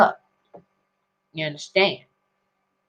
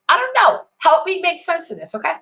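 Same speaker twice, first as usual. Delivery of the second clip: incredulous squeak; 9 semitones higher, faster than usual. Real, not acted. Mock bafflement at policy.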